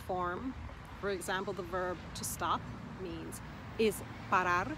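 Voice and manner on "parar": "parar" stressed on its final "rar"; last-syllable stress. Shown as a demonstration. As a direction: land the weight on the end of "parar". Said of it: "emphasis is on the last syllable".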